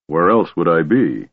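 ordinary delivery, nothing marked

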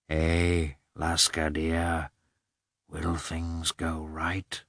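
soft and slow to the dog; gentle, fond question; tender. A narrator's reading. Hunter speaking fondly to dog.

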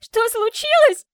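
high shrill shriek. Acted. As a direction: shriek it back at him. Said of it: "взвизгнула она".

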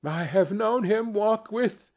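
cheerful line breaking off mid-phrase; a mother's brave words faltering. A narrator's reading. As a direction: let the voice catch and stop short. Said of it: "steady, cheerful voice that only faltered once".

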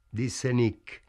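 ordinary delivery

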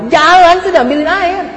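high, loud burst of command; mock exasperated order. Husband ordering questioning wife to fetch water, mock exasperated shout.

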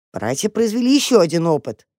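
ordinary delivery

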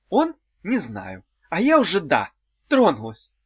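high, loud and bubbly; cheerful. Acted. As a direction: chirp it out merrily. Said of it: "жизнерадостно сказала из темноты Улита".

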